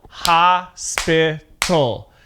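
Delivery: HOSPITAL broken slowly into three beats; slow, separated syllables on "Hospital"; split into syllables. Shown as a demonstration. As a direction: draw out "Hospital" syllable by syllable, hos-pi-tal. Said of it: "That's three different syllables".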